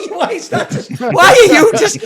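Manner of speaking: high, laughing outrage at the critic; can't get the words out for laughing, pitch jumping up; laughing disbelief. Real, not acted. Laughing at Ebert's odd review.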